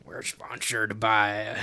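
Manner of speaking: slow Southern drawl, dog voice; a lazy deep South drawl; deep south accent. Pretend. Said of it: "a deep Southern accent".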